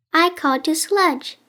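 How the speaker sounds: ordinary delivery, nothing marked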